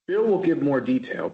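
ordinary talk

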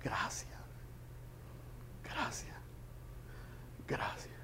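barely audible whispered thanks; tearful whisper. Retold or imitated. Crying father thanks the preacher who stopped his son, tearful whisper. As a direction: breathe the thanks out, broken by tears. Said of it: "el padre llorando me dice".

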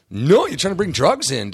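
lightly acted accusing outburst; retold indignant refusal. Guard refuses the fan entry, mock indignant refusal.

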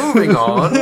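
loud, high, mock announcer; brisk presenter voice. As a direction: snap it off like a hurried host. Mocking presenter brusquely.

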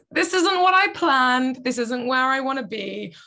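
ordinary talk

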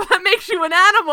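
talking back to a TV vampire while laughing; laughing retort. Retold or imitated. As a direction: laugh it out in disbelief. Mock argument with the character.

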